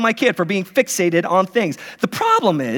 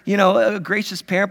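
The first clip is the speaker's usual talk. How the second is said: ordinary talk, nothing marked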